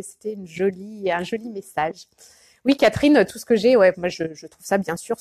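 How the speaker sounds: ordinary talk, nothing marked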